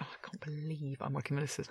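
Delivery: very soft, airy whisper; whispered awe. Retold or imitated. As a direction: whisper it to yourself, starstruck. Secret awe at working with co-star.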